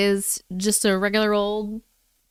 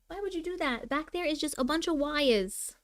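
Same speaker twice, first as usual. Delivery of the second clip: higher and softer, talking to a pet; 5 semitones higher, 9 dB quieter than usual. Real, not acted. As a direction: gently scold the cat in a high coo. Scolding the cat.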